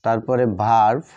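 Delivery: ordinary talk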